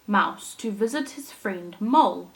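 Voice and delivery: put-on lively storytelling for children; animated read-aloud for little listeners; story narration. A narrator's reading. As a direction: read it like a picture book to a class. Teacher reads story aloud.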